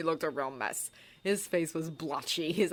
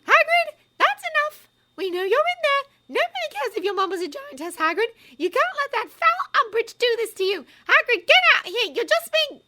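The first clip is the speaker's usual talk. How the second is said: high, loud, angry shouting; 10 dB louder than usual. A narrator's reading. Hermione yelling through Hagrid's door, high loud shout. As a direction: bang on the door and yell. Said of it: "Hermione shouted".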